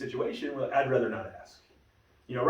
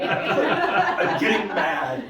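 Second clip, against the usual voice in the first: louder, drawn-out mock warning through laughter; laughing mock anger; 5 semitones higher, 7 dB louder, much slower than usual. Husband replaying his gym warning to his wife, laughing mock anger. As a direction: play up being mad, amused.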